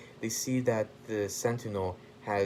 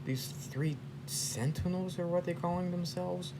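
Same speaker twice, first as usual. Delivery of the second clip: amazed bystander; 10 semitones higher than usual. Acted. Onlookers reporting the robots' victory, high-pitched mock amazement.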